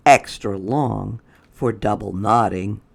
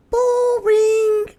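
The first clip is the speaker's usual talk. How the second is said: very high, louder put-on voice; Lily's voice; 5 dB louder than usual. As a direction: pipe the line way up high. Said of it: "said Lily".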